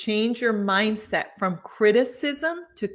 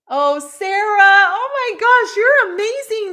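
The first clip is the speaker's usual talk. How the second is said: higher and louder, delighted gush; excited greeting; 11 semitones higher, 8 dB louder than usual. Real, not acted. To Sarah who stayed on the stream, a high delighted gush.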